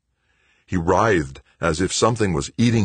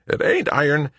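high-pitched grumbling retort; testy character voice, pitched up; muttered retort; 11 semitones higher, 4 dB louder than usual. A narrator's reading. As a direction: grumble back in a higher voice. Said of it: "Wojo muttered".

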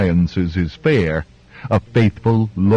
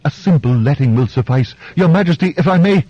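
pleading doctor; 6 semitones higher, faster than usual. Acted.

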